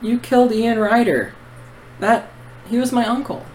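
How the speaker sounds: put-on a boy facing the man who killed his uncle; teenage Alex accusing. Alex accusing the assassin of killing his uncle, high and hurt.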